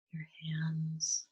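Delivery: unhurried, even-toned calm, phrase by phrase; guided meditation. Real, not acted. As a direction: ease them gently into relaxation, leaving space to breathe.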